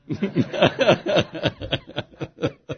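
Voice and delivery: real laugh. Real, not acted. Laughing at the punchline.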